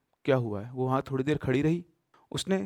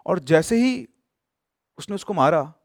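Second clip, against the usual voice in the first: plain reading voice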